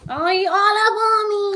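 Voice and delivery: loud teasing complaint, pitched high; mock-outraged shout at being crowded; playful shout. Real, not acted.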